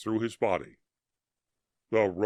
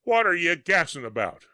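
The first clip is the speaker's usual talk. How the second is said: higher and louder, impatient; gruff shout; 6 semitones higher, 4 dB louder than usual. A narrator's reading. Soldier yelling at his mate mid-battle, impatient. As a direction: bark it at your chattering mate.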